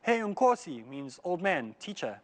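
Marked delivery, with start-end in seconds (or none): 0.0-0.9 mild raised call, higher pitch; shouting after someone. Retold or imitated. New owner calling after the departing old owner, mild shout. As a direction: call out to a man walking away. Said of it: "shouts to him".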